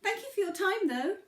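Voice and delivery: high, loud little pebble voice. Acted. Pebble calls out to the moose, high eager voice. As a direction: pitch it way up as the small, eager pebble. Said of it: "said the pebble".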